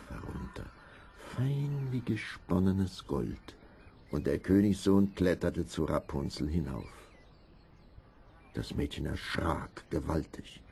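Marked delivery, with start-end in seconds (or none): none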